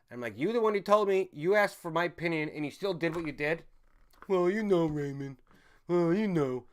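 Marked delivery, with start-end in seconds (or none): 0.4-3.6 raised, higher-pitched scolding; retold yelling. Streamer retelling how he yells at relatives who still vote, raised scolding. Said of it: "I, I yell at them". 4.3-6.6 loud, high, drawn-out mimicry; mocking relatives' excuse. Streamer imitating relatives excusing their vote, mocking.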